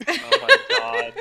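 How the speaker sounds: high, loud and slow through laughter; laughing. Real, not acted. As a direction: squeal it out mid-laugh. Cracking up at the joke.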